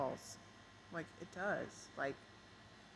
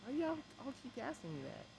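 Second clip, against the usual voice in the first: exasperated question; 8 semitones higher, 4 dB quieter than usual. Retold or imitated. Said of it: "I was just kinda like".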